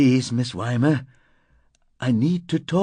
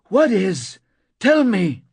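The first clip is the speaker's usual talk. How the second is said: raised, insistent demand; angry shout; 10 semitones higher, 5 dB louder, slower than usual. A narrator's reading. Narrator presses the moaning woman, angry shout. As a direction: shout for an answer. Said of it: "I shouted, angry".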